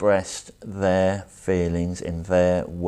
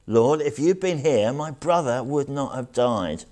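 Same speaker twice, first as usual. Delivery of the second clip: raised, pleading lament; grieving plea; 6 semitones higher than usual. Acted. Mary falls at Jesus' feet over her brother, grieving plea. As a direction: read as a reproachful cry, higher in pitch.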